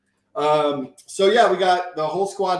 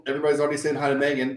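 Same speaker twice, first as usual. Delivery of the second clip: normal talking voice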